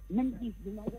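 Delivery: sudden drop, far from the phone; faint, away from the handset. Real, not acted. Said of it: "je vous entends plus, là, à nouveau".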